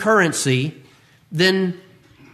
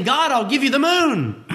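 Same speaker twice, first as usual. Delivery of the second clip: lightly voiced naive giver, higher; mock offer to God; 5 semitones higher than usual. Preacher mocks someone offering God the moon, higher voice. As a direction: play the foolish offerer, a touch high. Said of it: "it's like saying".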